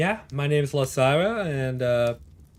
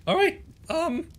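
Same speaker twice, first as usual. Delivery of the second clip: put-on high, nervous young voice; a scrawny kid trying to sound brave; pitched far up, timid but eager; Willy's voice; 13 semitones higher than usual. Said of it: "Wilson will just go".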